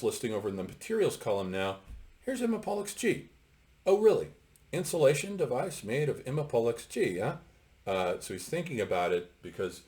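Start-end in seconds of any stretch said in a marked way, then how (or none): 2.2-3.3 character reading aloud. Acted. Character spots name in documents. 3.8-4.3 high, louder startled "Oh, really?"; surprised character. Acted. Character reacts to discovery. 4.7-7.4 higher, wondering read of the label; musing character voice. Acted. Character muses over the finding.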